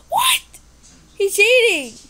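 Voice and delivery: high loud indignant cry; outraged disbelief. Real, not acted. Player catching a rival cheating, outraged high cry. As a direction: shout at the cheater.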